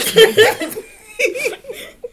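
high burst of laughter; loud laugh. Real, not acted. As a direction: break into laughter. Laughing at the memory.

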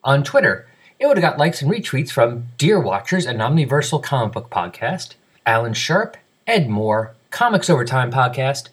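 brisk, emphatic podcast announcer delivery; scripted announcer read. Acted. Host reads feedback and listener names to the audience, announcer style. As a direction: read it out crisply with swinging, sing-song list intonation.

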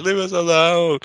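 high shouted quote; shouting movie line. Retold or imitated. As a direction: shout it like the scream queen. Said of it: "she shouts it out".

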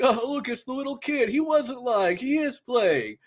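high-pitched taunting imitation; teasing mock surprise, way up high; mocking amusement. Voices players mocking the little kid.

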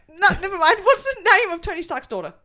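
can't stop laughing while asking. Real, not acted. Host cracks up mid-quiz.

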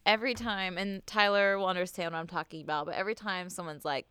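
ordinary talk, nothing marked

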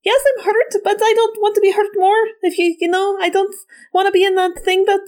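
high, tearful, halting voice; frightened hurt character. Acted. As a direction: play it pleading and close to tears. Hurt character meets a stranger.

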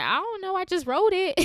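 high, sheepish protest breaking into a laugh; shrinking bashfully. Retold or imitated. Answering people who praise her writing, sheepish. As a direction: play yourself shrinking from praise, pitched right up. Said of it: "shrink a little bit".

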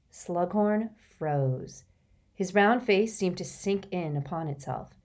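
usual speaking voice

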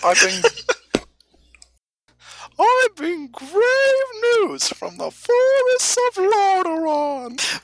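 very high, drawn-out falsetto proclamation; cartoonish squeaky herald announcing dire news; guild crier. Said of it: "Guild Crier enters".

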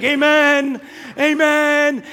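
high, loud amen; loud running amens; shouted amens. Retold or imitated. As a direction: call out agreement. Friends affirming while running.

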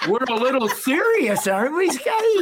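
high mock-stern ribbing; playful tease; teasing. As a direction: needle him with a grin. Teasing a serious old friend.

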